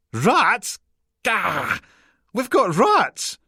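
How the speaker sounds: high, loud squeal of alarm; horrified resident. A narrator's reading. Resident hearing from the council about rats, high horrified outcry. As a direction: shriek it, stretching the last cry.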